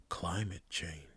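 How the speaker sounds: hushed, secretive whisper. Pretend. Podcaster saying a forbidden term so nobody stops them, whispered. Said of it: "Should I whisper it?".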